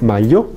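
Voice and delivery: "mayo" said as "mallo", with a palatal l; mayo mal pronunciado. Shown as a demonstration. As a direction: put an l-like contact into "mayo". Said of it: "No, no es mayo". («mayo» como «mallo», con lengua al paladar)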